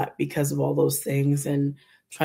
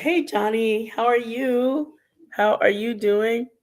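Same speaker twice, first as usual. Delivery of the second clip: high, slow, bright hello; sing-song greeting; 7 semitones higher, slower than usual. Pretend. Sweet high greeting to Johnny, slowed down. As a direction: call out sweetly, drawn out.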